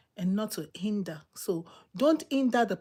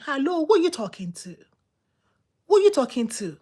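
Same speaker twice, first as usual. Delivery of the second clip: higher-pitched indignant back-talk; disrespectful challenge; 5 semitones higher, 4 dB louder, faster than usual. Retold or imitated. Wife challenging her husband, indignant disrespectful mimicry. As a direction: imitate an affronted wife answering back. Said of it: "there's a way you don't talk to the man".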